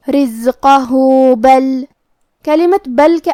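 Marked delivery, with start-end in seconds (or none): 0.1-1.8 رزقہ with the final ہا stretched into a long u; with madd. Shown as a demonstration. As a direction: lengthen the ending of رزقہ. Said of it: "مد کو ظاہر کریں گے". (رزقہ میں مد صلہ)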